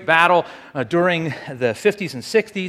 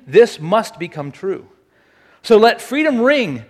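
dramatic speech reading; 4 semitones higher, 3 dB louder than usual. Acted. Speaker quizzing audience on famous freedom speeches, stirring oratory. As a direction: proclaim it like a stirring public address.

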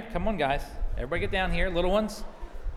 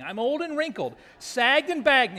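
higher, complaining storybook woman; Naomi; 6 semitones higher, 5 dB louder than usual. Acted. Old widow lamenting her looks, hammed-up higher voice. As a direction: play up the self-pity for children. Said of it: "This is Naomi speaking".

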